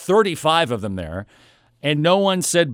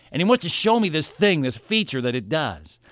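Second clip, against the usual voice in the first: normal talking voice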